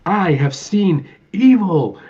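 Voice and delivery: mock-Shakespearean pronouncement; grand theatrical Gomez. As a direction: hold each word like a stage tragedian. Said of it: "he's like".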